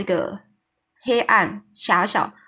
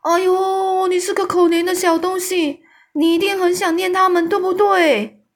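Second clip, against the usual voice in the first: high, loud, booming giant voice calling out in pity; big kind giant crying out in sympathy, pitched up and loud; 10 semitones higher, 5 dB louder than usual. A narrator's reading. Kind giant cries out his pity to the orphan Sophie, high and loud. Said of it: "友善的大巨人就叫着说".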